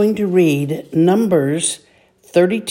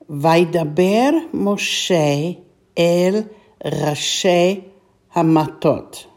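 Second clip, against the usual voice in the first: slow, drawn-out Hebrew phrase; slow Hebrew reading; slower than usual. Shown as a demonstration. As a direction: read it slowly, each word apart. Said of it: "this phrase altogether".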